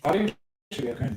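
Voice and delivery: choppy breaking-up audio; glitchy robotic call. Real, not acted. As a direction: voice cutting in and out. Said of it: "Your audio's breaking up".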